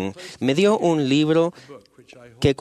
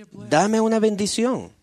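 higher-pitched, understated begging; retold plea; 9 semitones higher than usual. Sick sailor begs his friend for a blessing, retold plea. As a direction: plead mildly, lifting the pitch, as a retold quote. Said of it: "tras lo cual me rogó".

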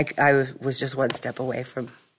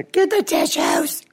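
put-on high, slow, exasperated teen voice; mock teen daughter; slower than usual. Daughter teasing her crying mom, high and slow. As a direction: drawl it out like an eye-rolling teenager. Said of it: "She's like".

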